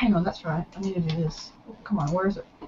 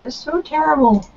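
voice cracking up an octave; 6 semitones higher than usual. Real, not acted. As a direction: voice breaking upward mid-phrase. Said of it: "My voice cracked".

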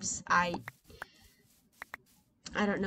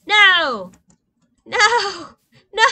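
much higher and louder, three cries of No; shouted dismay; 10 semitones higher, 15 dB louder than usual. Real, not acted. Gamer sees no slime spawn, shouted dismay. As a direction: yell it out in mock despair.

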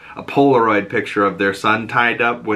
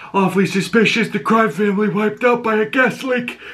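higher, strained chief voice; raspy police chief; 10 semitones higher than usual. Retold or imitated. Police chief questions Sophia, strained raspy voice. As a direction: push it from the back of the throat. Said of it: "raspy voiced police chief go".

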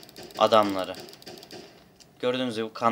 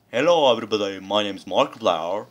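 imitating Markiplier; 4 dB louder than usual. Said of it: "Ayı gibi konuşuyor".

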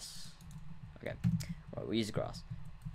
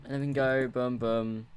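ordinary delivery

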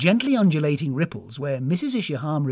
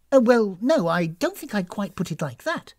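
hesitant, higher start, mildly flustered; disconcerted old lady; 6 semitones higher, 4 dB louder than usual. A narrator's reading. Hostess answering guest's dark remark, a little disconcerted. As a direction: demur with flustered politeness. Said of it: "a little disconcerted".

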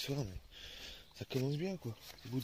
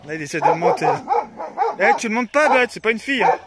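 loud scolding at the dog; shouting at the dog; 12 semitones higher, 17 dB louder than usual. Real, not acted. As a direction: call the dog off loudly. Calls to dogs mounting each other. (gronder le chien en criant)

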